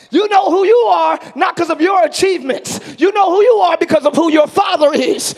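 rhythmic, shouted sermon climax; full-force preaching. Real, not acted. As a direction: hammer each promise home, stretching the answers.

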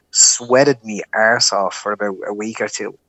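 ordinary talk

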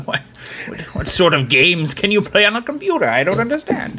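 baffled Brit confronted with a computer; British accent. Pretend. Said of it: "with a British accent".

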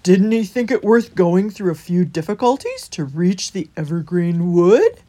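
loud, incredulous character voice; wondering hedgehog. A narrator's reading. Holgate wondering why the rabbit gave up, loud questioning. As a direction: ask it big, stretching the ending. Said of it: "wondered Holgate".

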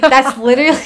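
breaks into a high laugh mid-phrase; giggling while telling it. Real, not acted. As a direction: laugh through the words, amused. Laughing at her own twitchy smile.

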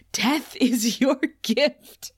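"Death is your gift." dragged out slowly, each word spaced; slow repetition. Retold or imitated. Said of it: "repeats it again".

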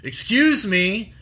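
loud, slow stern "Excuse me."; stern supervisor. Retold or imitated. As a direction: play the supervisor stopping a shopper, drawn out and loud. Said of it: "comes up to me and goes".

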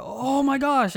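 high, drawn-out mock cry of shock; mock yell. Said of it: "if you could just yell".

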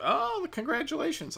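mock a gushing phone agent, pitch way up; chirpy bank clerk. Bank clerk congratulating a customer on his engagement ring, chirpy mockery.

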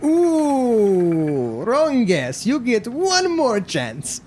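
high, drawn-out game show host; hammed-up announcer slipping into another accent; host with changed accent. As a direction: stretch a mock-pitying ooh, then slow down. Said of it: "changed his whole fucking accent".

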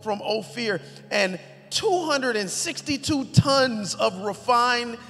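loud, high preaching read; emphatic scripture reading. Acted. Pastor reading David's gifts to the church, booming. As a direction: declaim each treasure with weight.

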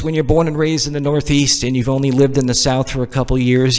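normal talking voice

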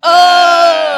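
loud, high shocked oh; surprised. Real, not acted. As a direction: shout it in shock. Reaction to joke about rejection.

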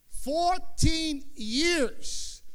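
dragged out very slowly. Acted. Preacher stretching the long waiting years. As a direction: let the years weigh.

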